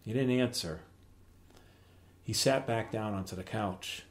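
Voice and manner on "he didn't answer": usual speaking voice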